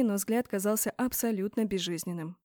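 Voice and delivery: ordinary delivery, nothing marked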